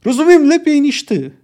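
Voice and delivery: high, loud yell. Acted. Ciri yells back at Wysogota, high and loud. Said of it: "wrzasnęła".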